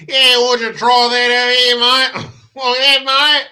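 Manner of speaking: hammed-up Australian accent; mock Australian accent. Mocking the video's Aussie blokes in an exaggerated Australian accent. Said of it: "does an Australian accent turn you on".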